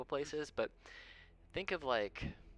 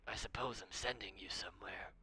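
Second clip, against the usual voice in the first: put-on softer, whispered old man mumbling to himself; drowsy whisper; 5 dB quieter than usual. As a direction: whisper as if half asleep. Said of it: "looks up at you all and goes".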